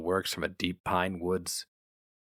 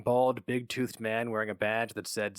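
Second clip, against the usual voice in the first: usual speaking voice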